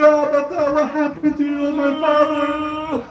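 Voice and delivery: high, loud, slow grieving cry to her father; wailing lament. Acted. Ruqayya crying over her father's head, high wailing lament. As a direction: wail it out as a bereaved child. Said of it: "and cries and wails".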